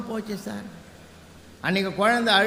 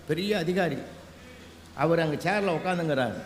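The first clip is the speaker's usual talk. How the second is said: normal talking voice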